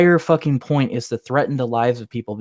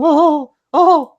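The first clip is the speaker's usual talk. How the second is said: high, loud mock wailing; 8 dB louder than usual. Mimicking upset opponents, high mock wail.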